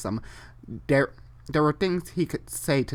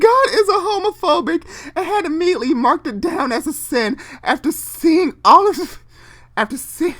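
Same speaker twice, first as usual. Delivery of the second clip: helpless laughter; 12 dB louder than usual. Real, not acted. Losing it over fanfic.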